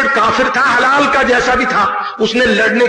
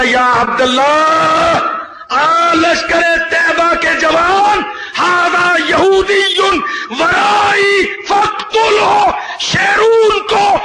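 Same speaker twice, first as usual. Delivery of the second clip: much higher, slow, shouted call of the stone; 7 semitones higher, slower than usual. Acted. Preacher voicing the stone calling to the fighter, high slow shout. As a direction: cry out slowly as the stone summoning fighters. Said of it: "پتھر کہے گا".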